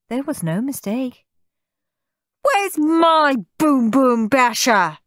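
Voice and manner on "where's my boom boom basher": high, loud, slow scream. A narrator's reading. Steve screams for his missing boom boom basher. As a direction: demand it furiously. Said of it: "screamed Steve".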